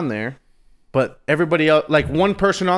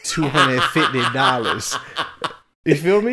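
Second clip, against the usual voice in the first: laughing. Real, not acted. Joking about the price.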